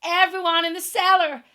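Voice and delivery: loud, high urgent call; Aunt Em shouting everyone into the cellar. Acted. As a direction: yell it down to everyone. Aunt Em warns of whirlwind.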